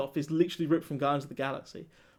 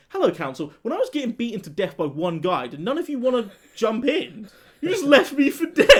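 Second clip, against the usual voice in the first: formal mock scolding building to a loud, high laugh; mock indignant; 6 semitones higher, 6 dB louder than usual. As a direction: address the council, indignation cracking into laughter. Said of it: "sitting everyone down for a council meeting and going".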